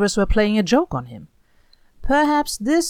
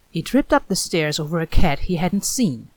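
usual speaking voice

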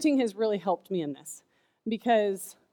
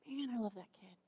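tender; 4 semitones higher, 9 dB quieter than usual. Retold or imitated. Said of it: "God's still looking at you going".

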